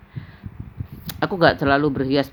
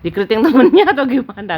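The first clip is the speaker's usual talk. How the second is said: giggling; 8 semitones higher, 8 dB louder, faster than usual. Real, not acted. Giggling at mum's hairdo.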